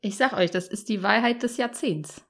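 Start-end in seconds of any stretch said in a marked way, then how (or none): none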